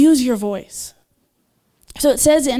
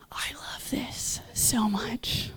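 whisper; 13 dB quieter, much slower than usual. Real, not acted. Fond aside to congregation.

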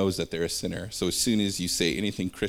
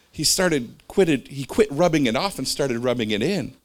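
normal talking voice

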